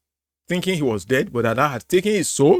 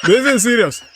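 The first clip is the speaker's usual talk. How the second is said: loud, laughing through words; 5 semitones higher, 5 dB louder than usual. Real, not acted. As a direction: try to sound serious while laughing. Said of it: "I can't stop laughing here".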